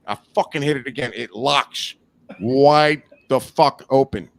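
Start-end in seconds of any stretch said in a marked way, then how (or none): 2.4-4.3 loud, slow punch on every word; emphatic. Real, not acted. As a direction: stretch it out loud for the climax. Emphatic climax of the story.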